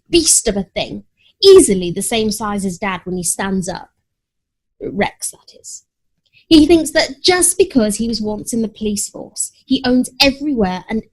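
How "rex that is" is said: unvoiced, hushed clarifying aside; hushed sly aside; whispered aside. A narrator's reading. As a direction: slip it in under your breath.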